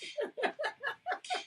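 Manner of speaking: a high burst of real laughter. Real, not acted. Laughing at own story.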